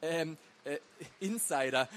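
flustered, halting excuse; stammering. A narrator's reading. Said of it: "stammelte Peer".

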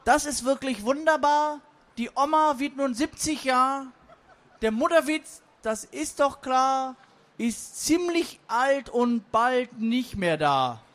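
high, sing-song verse delivery; forced-rhyme reciter. Acted. Forced rhyme answers with a birthday verse, sing-song. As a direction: recite it in bouncy doggerel, slowing at the end. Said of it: "entgegnete das Zweckreim-Massaker".

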